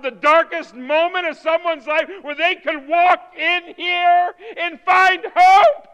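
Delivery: loud, high sermon climax building to a shout; impassioned shouted plea; full-force preaching. Real, not acted. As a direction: drive each question harder, stretching the last words.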